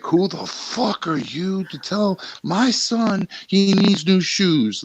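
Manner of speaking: high, slow, drawn-out mock rage; furious mom. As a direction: spit it out as the outraged mother. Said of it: "and goes like".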